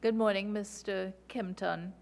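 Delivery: ordinary talk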